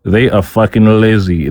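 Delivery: ordinary delivery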